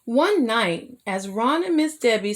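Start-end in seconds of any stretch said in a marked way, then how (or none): none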